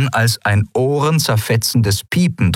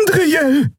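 very high, urgent cry; called out. A narrator's reading. Xea calling to Endriel mid-fight, high cry. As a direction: cry out her name. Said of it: "rief Xea".

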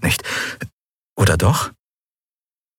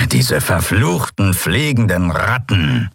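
snorting scorn; slower than usual. Acted. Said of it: "schnaubte Chasu".

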